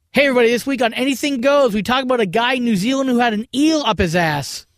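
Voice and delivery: loud, high announcer read; radio promo voice. Acted.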